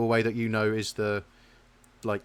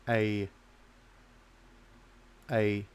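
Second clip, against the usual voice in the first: ordinary talk, nothing marked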